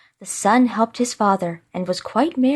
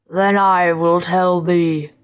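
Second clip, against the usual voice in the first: slow, menacing giant spirit; awful spirit voice; 2 semitones lower, 3 dB louder, slower than usual. A narrator's reading. Freed spirit towers over the scholar, awful voice. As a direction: draw it out in a dreadful monster voice. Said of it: "cried the spirit".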